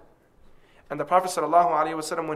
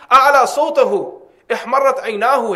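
louder, higher impassioned recitation; raised voice; 6 semitones higher, 9 dB louder, faster than usual. Retold or imitated. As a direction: lift the voice up like a passionate speaker. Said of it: "his voice would lift up".

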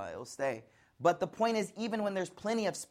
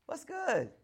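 high falling "What's good?"; 7 semitones higher, 5 dB quieter than usual. Shown as a demonstration. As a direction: start "What's good?" high and let it fall, softer. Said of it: "All three of those was, like, three different things".

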